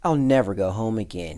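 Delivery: loud start sinking into a sigh; blissfully sighed declaration; contented sigh. A narrator's reading. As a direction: sigh it out with pleasure. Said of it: "he sighed".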